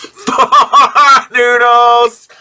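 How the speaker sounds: laughing, slow and loud. Real, not acted. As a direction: laugh through the words. Laughing at the dropped noodles.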